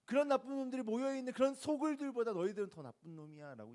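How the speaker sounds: loud, high scolding, as if quoting Jesus; angry rebuke. Retold or imitated. Preacher voicing Jesus rebuking wicked towns, angry and loud. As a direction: raise the voice in a preacher's acted condemnation. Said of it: "예수님이 이렇게 화낸 이유".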